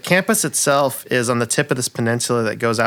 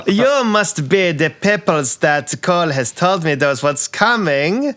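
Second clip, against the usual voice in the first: campy drag queen; 7 semitones higher than usual. Acted. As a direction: gush like a flamboyant diva. GM voices flamboyant bird NPC.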